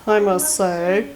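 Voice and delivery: a slow, put-on Ed Grimley impression; quick, light imitation of a nerdy comic character, slowed down. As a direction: drag the title out like the goofy comic character. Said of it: "breaking into the Ed Grimley voice".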